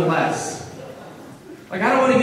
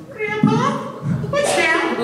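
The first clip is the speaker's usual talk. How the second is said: put-on much higher small child's question; curious granddaughter; slower than usual. Granddaughter on grandpa's knee asking, high childlike voice. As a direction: ask it high and innocent like a little girl. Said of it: "And she goes".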